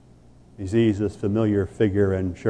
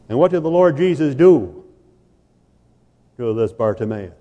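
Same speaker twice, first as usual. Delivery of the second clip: higher, louder pulpit question; rising sermon question; forceful preaching; 4 semitones higher, 3 dB louder than usual. Acted. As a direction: fling the question out to the pews.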